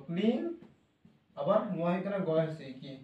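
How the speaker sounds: usual speaking voice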